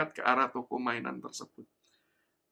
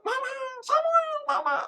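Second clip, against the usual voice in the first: put-on child calling; 7 dB louder than usual. Child calls back to mother.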